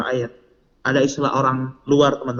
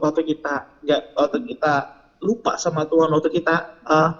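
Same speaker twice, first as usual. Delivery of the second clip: ordinary delivery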